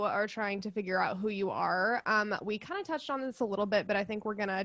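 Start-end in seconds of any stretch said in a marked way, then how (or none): none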